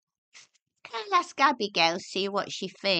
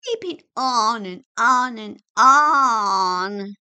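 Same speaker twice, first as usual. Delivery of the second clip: exasperated; 12 dB louder, slower than usual. Acted. Exasperated child nagging back.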